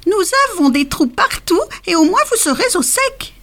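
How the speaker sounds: voix de souris très aiguë; voix du roi des souris. A narrator's reading. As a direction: proposer d'une petite voix perchée. (le roi des souris propose ses galeries)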